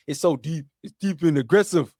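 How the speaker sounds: put-on loud, rough try at an African accent; imitation African accent, forceful; attempted African accent. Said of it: "that sound more African".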